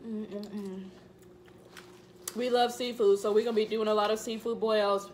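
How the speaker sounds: talking with mouth full; speaking between bites; chewing food. Real, not acted. Seen 0: Woman bites food just before, then talks while chewing, then drinks.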